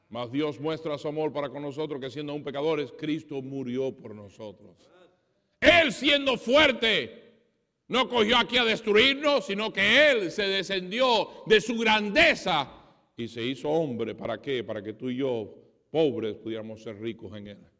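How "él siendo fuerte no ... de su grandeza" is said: loud, slow and high, thundering from the pulpit; preacher proclaiming God's might, loud and drawn out; full-force preaching. Acted. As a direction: bellow the words slowly to the congregation.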